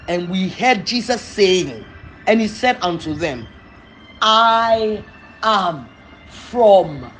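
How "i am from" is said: slow solemn quote. Acted. Quoting Jesus solemnly.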